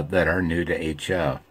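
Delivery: ordinary talk, nothing marked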